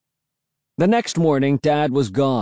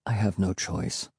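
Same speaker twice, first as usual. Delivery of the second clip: very soft, low murmur; hushed, lowered resignation; just above a whisper; 4 semitones lower, 7 dB quieter than usual. Acted. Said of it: "his voice just above a whisper".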